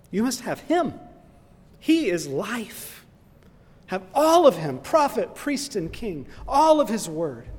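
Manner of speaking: high, loud preaching cry; loud, high pulpit call to take all of Christ; preaching at full force. Acted. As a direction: proclaim it, building to a shout. Sermon climax.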